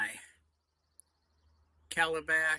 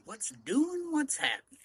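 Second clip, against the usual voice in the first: high, loud, upbeat hello; excited greeting; 12 semitones higher, 7 dB louder than usual. Real, not acted. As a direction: call out to the viewers with energy. Vlog greeting, calling out to viewers.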